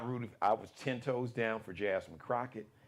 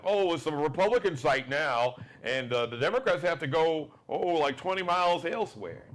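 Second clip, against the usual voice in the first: mocking poll worker; 4 semitones higher, 5 dB louder than usual. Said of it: "And they were like".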